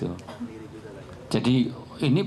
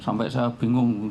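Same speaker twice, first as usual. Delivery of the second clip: ordinary talk, nothing marked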